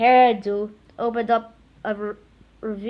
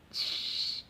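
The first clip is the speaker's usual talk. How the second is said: spray hiss; 11 dB quieter than usual. Acted. Spray can sound.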